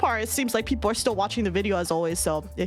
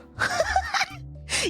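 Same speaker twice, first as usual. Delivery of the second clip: high giggle. Real, not acted. Laughing at own plug.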